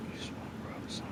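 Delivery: soft whisper. Real, not acted. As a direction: whisper it to the horse. Petting the horse.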